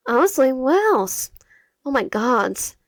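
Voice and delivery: sarcastic reply to an unwelcome sister. Acted. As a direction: lay the false delight on thick. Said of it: "hear the sarcasm in my voice".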